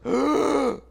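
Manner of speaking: big, loud intake of breath; imitated gasp. Girl looking down the first drop, gasping. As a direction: suck in a sharp, frightened gasp. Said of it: "like that".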